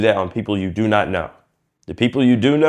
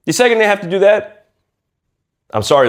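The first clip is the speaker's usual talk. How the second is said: ordinary talk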